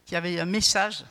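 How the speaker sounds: usual speaking voice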